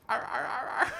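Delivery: high wailing "arr" sobs; sad crocodile crying. Acted. Crocodile in the corner as his mother cries, high mock sobbing. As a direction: wail like a comic heartbroken crocodile. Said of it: "It's a sad crocodile in the corner".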